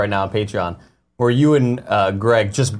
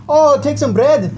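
put-on high, loud Scottish-accented hobbit; drawn-out mock Scottish accent, pitched way up; 5 dB louder than usual. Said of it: "Why are the hobbits Scottish?".

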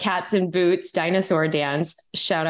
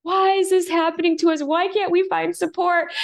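distraught, much higher pitched complaint; retold victim wail; 12 semitones higher than usual. Mother crying to her husband about no support, distraught high wail. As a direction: replay the despairing self-pity, pitched way up. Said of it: "here I am having a victim moment".

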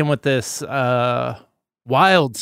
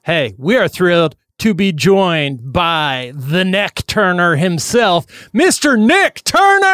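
slow, drawn-out build to a high, loud name; big show-opening introduction, climbing at the end; hype announcer intro; 3 semitones higher, 3 dB louder, slower than usual. Acted. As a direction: announce the guest like a ring announcer.

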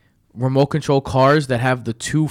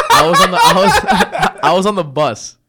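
high laughing start; 7 semitones higher, 6 dB louder than usual. Real, not acted. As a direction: giggle through the opening. Laughing starting own story.

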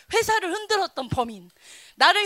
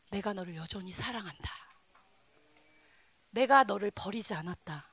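much softer, gentle voice of God; a hushed, tender voice of God; tender divine voice; 7 semitones lower, 8 dB quieter than usual. Acted. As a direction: speak it low and tender, as God comforting. Voicing God's gentle words.

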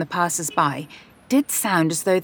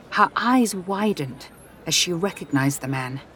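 usual speaking voice

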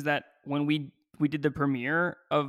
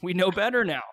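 higher, louder imitated disapproval; mock exasperation; 4 semitones higher, 7 dB louder than usual. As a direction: play appalled listeners. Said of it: "they're like".

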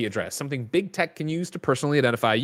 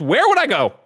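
higher, louder set-up question; raised cue question; 9 semitones higher, 5 dB louder than usual. Pretend. Host cues the co-host for the list, raised cue question. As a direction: throw the question out high and loud.

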